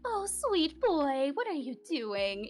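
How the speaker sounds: sing-song Madame Mystère. Acted. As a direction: croon it slowly like a mysterious madame. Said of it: "having kind of, like, a sing-song to her voice".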